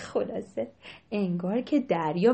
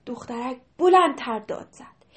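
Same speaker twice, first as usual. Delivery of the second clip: usual speaking voice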